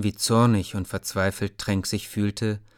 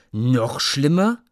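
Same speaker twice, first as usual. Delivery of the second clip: slow, higher, louder bitter echo; a boy's bitter, slow retort; angry retort; 4 semitones higher, 4 dB louder, slower than usual. Acted. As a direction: throw the words back angrily. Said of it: "sagte Tränk böse".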